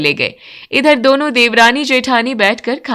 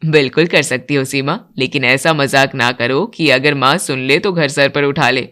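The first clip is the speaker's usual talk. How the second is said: much lower, steady male voice; older man; 9 semitones lower than usual. Acted. Father and son praising the bride's cooking, much lower voice. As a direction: speak as the elder men of the house. Vijay answers wife.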